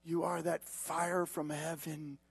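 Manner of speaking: soft, intimate closing appeal; quiet, lingering pulpit delivery, slowing at the end; hushed preaching. Real, not acted.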